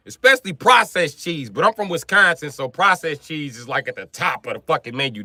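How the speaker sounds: normal talking voice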